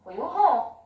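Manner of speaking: ordinary talk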